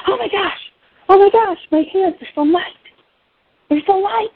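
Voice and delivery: high-pitched amazed cry, retold; mock screaming. Patient amazed at suddenly light hands, mock screaming. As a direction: relive an astonished squeal without full screaming. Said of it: "And I start screaming".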